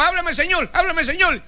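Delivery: high, pleading mock prayer; lightly mocking a begging worshipper. Mocking churchgoers who beg God to speak, pleading. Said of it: "Pero algunos".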